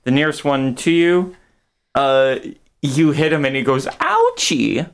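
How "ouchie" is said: very high, whiny "ouchie"; squeaky monster whimper. Acted. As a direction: squeal it like a wimpy creature. GM voices hurt monster.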